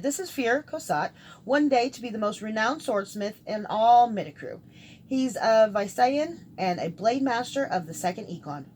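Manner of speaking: grand introduction. Acted. Mage introducing swordsmith friend to captive, mildly grand. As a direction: present your companion with a light flourish. Dorian introduces his companion.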